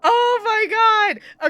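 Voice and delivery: slow, high, loud amazed gasp of words; stunned exclamation. Real, not acted. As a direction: drag it out in disbelief. Stunned by the foreshadowing.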